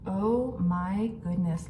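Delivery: ordinary talk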